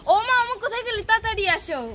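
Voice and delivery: put-on child hollering for mum; calling out. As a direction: summon her urgently from another room. Child calls mother to prank her.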